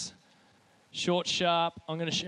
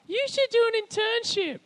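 very high, loud mocking imitation; mimicked advice-giver voice; 9 dB louder than usual. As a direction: squawk it as an exaggerated impression. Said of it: "the voice is that you, um, pretended to be".

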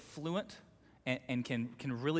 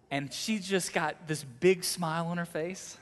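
normal talking voice

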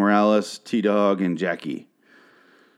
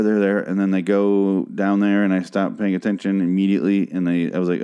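usual speaking voice

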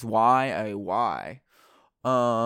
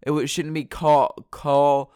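usual speaking voice

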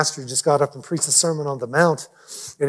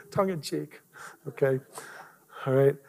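ordinary talk

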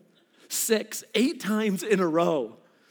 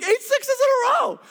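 much higher, louder, stunned delight; high squealing triumph; ecstatic announcement; 5 dB louder than usual. Retold or imitated. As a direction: announce it like a miracle. Reliving excitement at rolling sixes.

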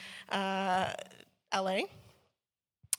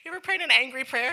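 ordinary delivery

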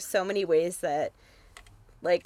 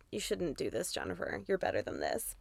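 quiet; 10 dB quieter than usual. Retold or imitated. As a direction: softly chide the TV. Talking to the TV.

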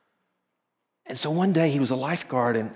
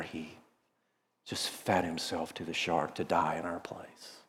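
slow hushed whisper; solemn whisper; 8 semitones lower, 12 dB quieter than usual. Real, not acted. Preacher telling how Jesus died, slow solemn whisper. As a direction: drop to a whisper for the sacrifice.

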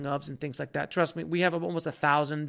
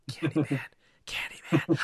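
soft, sly whispered summons; hushed name-calling; 2 semitones lower, 5 dB quieter than usual. Pretend. Fan tempted to summon the actor behind him, hushed. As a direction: whisper it like a forbidden chant.

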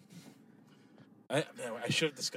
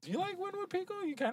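playful high question; 5 dB quieter than usual. Real, not acted.

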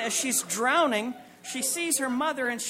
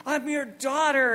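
mother's outrage; 5 semitones higher than usual. Retold or imitated. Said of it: "And she says".

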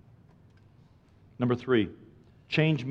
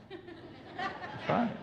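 giggled through, high and quiet; laughing; 13 dB quieter than usual. Real, not acted. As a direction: laugh as you repeat it. Repeats while laughing.

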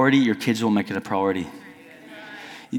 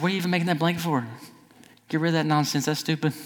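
quick, higher-pitched petty dig; 5 semitones higher, 4 dB quieter, faster than usual. Retold or imitated. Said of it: "I decided to be real petty".

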